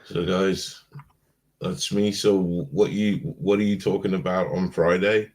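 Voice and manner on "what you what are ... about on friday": ordinary delivery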